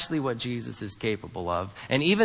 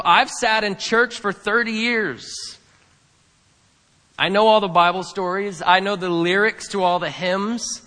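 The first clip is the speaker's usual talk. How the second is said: smug churchgoer; 7 semitones higher, 5 dB louder than usual. Retold or imitated. Preacher voicing a smug churchgoer.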